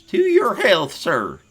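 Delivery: high, loud hearty farmer voice; barley farmer. Acted.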